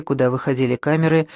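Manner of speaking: ordinary delivery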